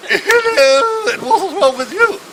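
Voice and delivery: very high, loud mock-regal hello; regal Queen. The Queen greets the next bed, in a high loud regal voice. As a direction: hail the patient like a hammed-up Queen. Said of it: "more regal".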